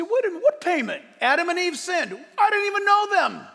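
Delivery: high, loud, disbelieving protest; mock outrage from a skeptic who never met them; incredulous objector. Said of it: "You say".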